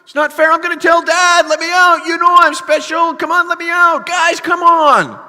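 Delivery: high, loud desperate pleading; full-volume panicked kid-brother wail; frantic yelling. Acted. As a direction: yell up out of a pit for your brothers.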